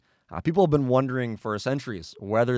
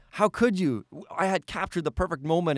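higher-pitched, mildly indignant protest; acted reproach; 6 semitones higher than usual. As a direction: play at being wronged by a friend. Acted reproach over torn-up photo.

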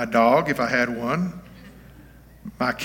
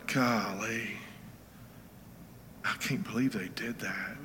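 soft sympathy; 2 semitones lower, 12 dB quieter than usual. Retold or imitated. Preacher voices a sympathetic reaction.

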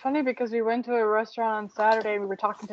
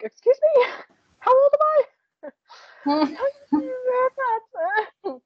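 much higher, laughing indignant excuse me; mock outrage; 13 semitones higher, faster than usual. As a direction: shriek it in offended disbelief. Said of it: "And I'm like".

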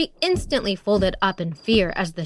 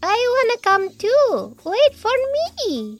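very high, squeaky little frog calling; 13 semitones higher than usual. A narrator's reading. Tiny frog calls after Beatriz and Porco, squeaky and high. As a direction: squeak it out, chasing after them. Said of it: "another squeaking voice".

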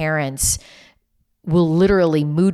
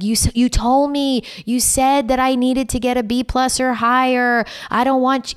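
upset daughter; 8 semitones higher than usual. Retold or imitated. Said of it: "and she was like".

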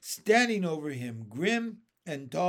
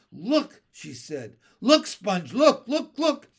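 rising, louder and higher looks; startled urging; 5 semitones higher, 10 dB louder than usual. Acted. Spiker urging Sponge to look, building to a shriek.

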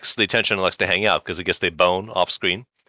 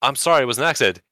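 hasty apology; 6 semitones higher, faster than usual. Retold or imitated. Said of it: "She says".

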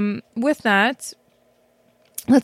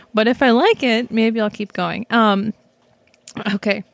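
ordinary talk

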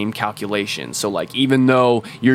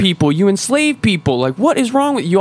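much higher, put-on voice throwing insults; mock assassin retort; 11 semitones higher, 3 dB louder than usual. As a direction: sneer it back at the dying tyrant. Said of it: "And you're just like".

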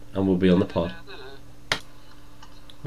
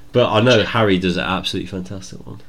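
usual speaking voice

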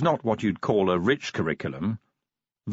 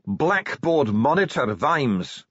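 higher-pitched dwarf accusation; Ardent, venomous; 7 semitones higher, 3 dB louder, slower than usual. A narrator's reading. Dwarf accuses the commander with his old title, venomously. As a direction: spit the title out like an accusation of murder. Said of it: "could not have put more venom".

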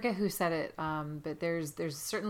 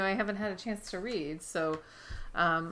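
ordinary delivery

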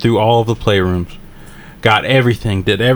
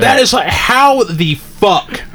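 exasperated disbelief; 7 semitones higher than usual. Real, not acted. To his friend, stunned by the hidden pipe, exasperated and drawn out. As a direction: stretch it out in outraged disbelief.

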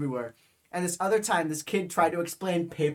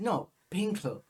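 higher, hammed-up German accent; 5 semitones higher than usual. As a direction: play it up in a silly German accent. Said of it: "a full German accent".

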